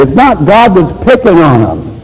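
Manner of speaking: ordinary talk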